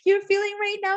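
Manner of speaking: very high, loud, breakneck sympathy; retold excited gushing. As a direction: gush as if bonding instantly with a new friend. Re-enacts gushing first bonding chat.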